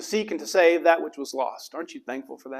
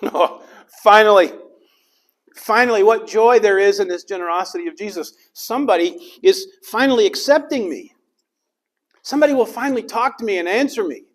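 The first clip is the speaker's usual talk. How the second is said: high, loud, joyful exclaiming; overjoyed; 8 semitones higher, 6 dB louder than usual. Acted. Zacchaeus rejoicing that someone finally accepts him, high and loud. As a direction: burst out with relief and joy.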